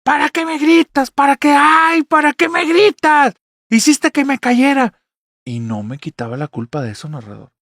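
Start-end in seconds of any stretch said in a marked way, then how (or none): 0.0-5.0 high, loud, slow furious scolding; angry scolding. Retold or imitated. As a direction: mom blames the child in anger. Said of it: "a hablar con mucho coraje". (madre regaña furiosa, aguda y fuerte)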